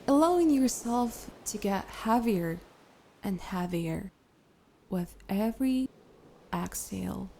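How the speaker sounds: unhurried, gentle breathing cues with long pauses; guided meditation. Acted. Leads breathing and relaxation.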